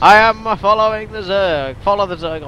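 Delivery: loud declaration. Real, not acted. Mock grand announcement.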